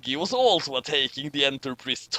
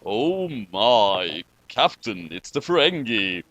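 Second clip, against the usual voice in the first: Sulu voice; 7 semitones lower, slower than usual. Acted. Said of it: "said Sulu".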